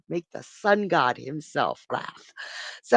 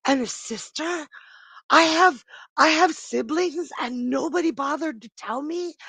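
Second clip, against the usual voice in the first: higher, urgent youthful outburst; indignant young ram; 9 semitones higher than usual. Acted. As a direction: burst in as a betrayed kid brother who won't be stopped. Young Chrysomelos bursts in indignant.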